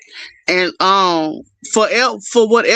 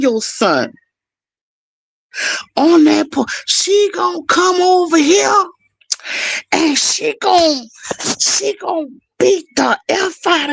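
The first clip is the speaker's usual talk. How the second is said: put-on high, tearful child threatening; mimicking the girl's teary threat; crying threat; 7 semitones higher than usual. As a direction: wail out the threat like a kid.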